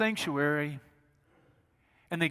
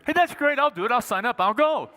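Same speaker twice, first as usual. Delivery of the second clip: high, louder, bubbly yes; imitating young Mary agreeing cheerfully; eager young Mary; 6 semitones higher, 5 dB louder than usual. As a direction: jump at the offer like a keen kid. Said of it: "and Mary goes".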